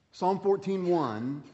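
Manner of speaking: normal talking voice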